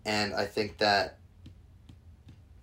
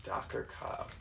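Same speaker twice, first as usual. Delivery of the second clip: whispered; 12 dB quieter than usual. Real, not acted.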